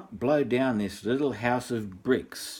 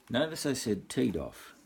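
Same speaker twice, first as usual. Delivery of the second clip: usual speaking voice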